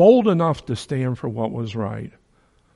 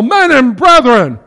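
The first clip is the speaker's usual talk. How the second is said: high, loud cry to the council; Paul crying out before the council; cried out; 11 semitones higher, 10 dB louder than usual. A narrator's reading. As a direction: proclaim it loud and high, slowing at the end. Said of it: "he cried out in the council".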